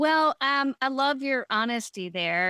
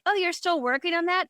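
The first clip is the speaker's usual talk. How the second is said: mildly mock-sweet question; 6 semitones higher, faster than usual. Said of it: "they're just like".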